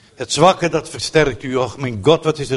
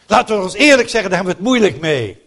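fervent outburst; 6 semitones higher, 4 dB louder, faster than usual. Real, not acted. Preacher bursts out.

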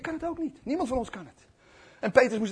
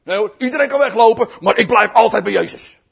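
cocky, raised-voice promise; acted overconfident proclamation; loud boastful vow; 4 semitones higher, 14 dB louder than usual. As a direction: declare loyalty at full volume. Peter boasts of loyalty, acted.

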